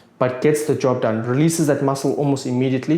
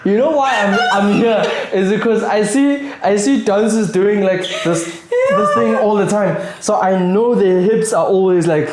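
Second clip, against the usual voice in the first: laughing; 7 semitones higher, 4 dB louder than usual. Real, not acted. Laughing while explaining.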